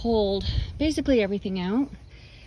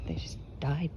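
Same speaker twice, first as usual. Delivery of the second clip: whispering; 5 semitones lower, 4 dB quieter than usual. Real, not acted. Lamenting plants killed by heat.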